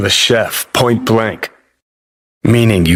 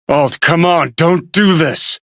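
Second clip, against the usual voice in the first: raised pitch, slowing as it pleads; urgent plea; 6 semitones higher than usual. Acted. Begging Alt not to do this, urgent. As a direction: plead with her to stop.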